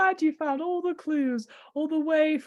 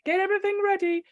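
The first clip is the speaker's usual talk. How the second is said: normal talking voice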